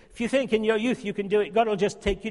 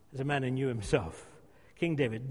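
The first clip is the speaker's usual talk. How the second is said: ordinary talk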